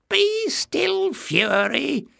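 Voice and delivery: very high-pitched scolding voice; malicious little man. A narrator's reading. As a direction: scold a pet in a high, mean voice. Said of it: "said Jinx".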